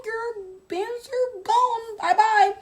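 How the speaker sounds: high, slow sing-song bye-bye; teasing mock-sweet farewell; sing-song taunt. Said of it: "I'm like".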